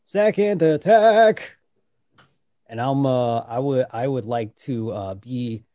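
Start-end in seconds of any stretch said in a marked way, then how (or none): 0.1-1.6 high, slow, gleeful announcement; excited drawn-out echo. Real, not acted. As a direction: relish the second swing. Player echoes with gusto.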